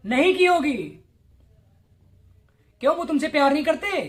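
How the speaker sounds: ordinary delivery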